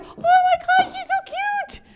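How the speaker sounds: very high squealy cooing; gushing over a dog. Retold or imitated. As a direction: coo like someone melting over a puppy.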